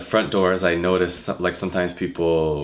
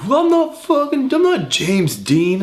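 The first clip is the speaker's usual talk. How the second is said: much higher, louder mock whine; squeaky indignant protest; 5 dB louder, slower than usual. Guy protesting to the manager, high and loud.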